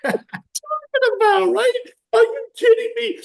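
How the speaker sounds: high, loud laughing outrage; laughing disbelief. Retold or imitated. Retelling his mother's accusation, high incredulous laugh. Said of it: "retelling his outrage, laughing".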